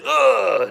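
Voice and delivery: high, loud grunt of a kick to the chest; winded grunt. Retold or imitated. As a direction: knock the air out in one grunt. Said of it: "T J went".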